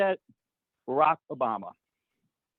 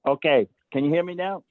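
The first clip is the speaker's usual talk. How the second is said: raised voice, louder and firmer; speaking up; 6 dB louder than usual. Real, not acted. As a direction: speak up so they can hear you. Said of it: "Could you speak up a little bit louder".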